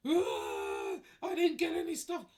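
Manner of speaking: high, loud mocking wail. Chef moaning about missing stuffing, mock wail. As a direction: ham up his grief like someone died. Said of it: "You'd have thought someone had died".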